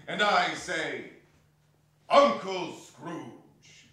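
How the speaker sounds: slow, loud drawn-out toast. Acted. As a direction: raise the glass and call it out. Raising a toast.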